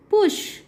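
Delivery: पुश said high with a clear "ush"; demo word. Shown as a demonstration. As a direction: model पुश brightly. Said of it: "जैसे बुल". ("पुश" ऊँचे सुर में)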